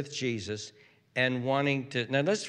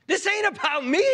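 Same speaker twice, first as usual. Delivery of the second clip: high-pitched acted protest; indignant correction; 4 dB louder than usual. Jesus calling the doubting father out, indignant. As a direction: push back hard, pitched way up.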